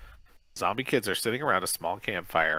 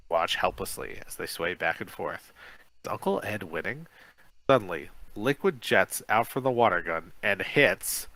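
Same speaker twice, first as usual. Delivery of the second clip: ordinary delivery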